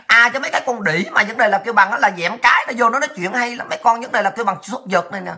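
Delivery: ordinary talk, nothing marked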